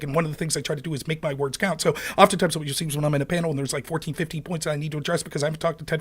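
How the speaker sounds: rapid-fire debater delivery; racing, densely packed argument; fast talking. Shown as a demonstration. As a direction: rattle it off nonstop without losing the words. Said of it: "to talk that fast".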